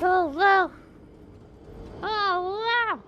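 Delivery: high, drawn-out wow of amazement; amazed. Real, not acted. Gamer awed by boss attack.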